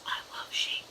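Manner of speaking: soft unvoiced creepy whisper. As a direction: breathe it like a creepy secret. Clown whispers after kiss.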